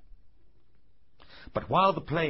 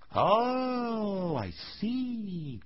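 dawning realisation; 8 semitones higher, much slower than usual. A narrator's reading. Old carpenter finds nobody in the shop, drawn-out realisation. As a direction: let it dawn on you slowly.

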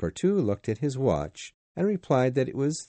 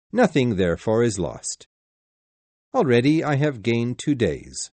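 confident gentleman voice; 4 dB louder than usual. Acted. Fogg calmly explains plan.

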